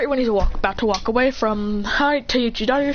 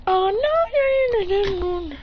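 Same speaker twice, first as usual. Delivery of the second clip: high, drawn-out mock wail; hammed-up moan of dread; acted dismay; 9 semitones higher than usual. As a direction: wail the noes slowly, starting very high and coming down. Character voices dismay in the story.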